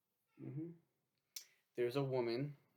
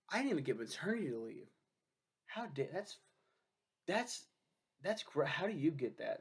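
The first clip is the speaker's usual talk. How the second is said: indignant coworker; 4 semitones higher than usual. Retold or imitated. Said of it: "And they're like".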